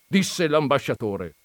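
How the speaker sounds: ordinary delivery, nothing marked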